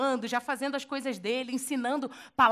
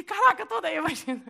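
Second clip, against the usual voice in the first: thrilled Pedro; 5 semitones higher than usual. Retold or imitated. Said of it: "e ele".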